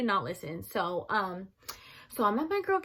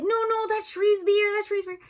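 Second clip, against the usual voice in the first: defensive friend; 12 semitones higher, 7 dB louder than usual. Retold or imitated. Friend deflecting blame.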